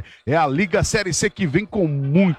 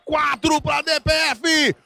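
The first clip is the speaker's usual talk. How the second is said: high shouted score call; excited score shout; 8 semitones higher, slower than usual. Real, not acted. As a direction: belt out the new score. Goal celebration score call.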